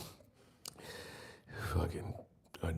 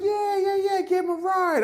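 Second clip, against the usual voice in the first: much higher, slow, dopey driver voice; Uber driver; 4 dB louder than usual. Retold or imitated. Comic mimics the driver misnaming the comic, high and dopey. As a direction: play the clueless driver, pitched way up. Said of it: "He's like".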